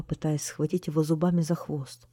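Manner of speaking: ordinary delivery, nothing marked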